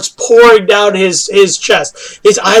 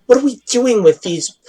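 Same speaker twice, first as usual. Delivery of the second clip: usual speaking voice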